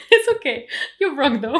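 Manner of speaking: loud, high burst of laughter through the words; giggling loudly at being teased; laughing loudly. Real, not acted.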